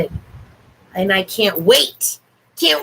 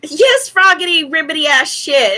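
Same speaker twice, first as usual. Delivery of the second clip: excited; 6 semitones higher, 5 dB louder than usual. Real, not acted. Calls dog excitedly.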